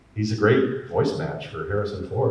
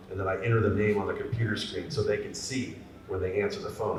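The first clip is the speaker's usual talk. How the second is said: ordinary talk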